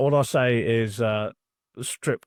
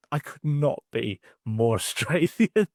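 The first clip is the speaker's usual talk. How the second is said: slow, higher mock Australian accent with a laugh; 4 semitones higher, slower than usual. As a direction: ham up an Australian twang, laughing. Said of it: "I can do an Australian accent".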